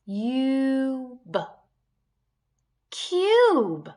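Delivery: long u held, then Cube said high and loud; sounding out cube. Shown as a demonstration. Teacher modelling the long vowel for kids, stretched sounds. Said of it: "I could flip the vowel sound".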